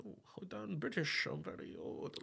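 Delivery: posh British. Retold or imitated. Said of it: "we think of Britain as, like, very classy".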